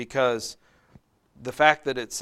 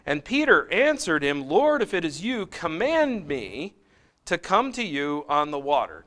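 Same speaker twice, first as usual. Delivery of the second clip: raised, measured Bible reading; slightly louder scripture reading voice; 5 semitones higher, 4 dB louder than usual. Acted. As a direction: read the passage out to the class. Reads scripture passage aloud.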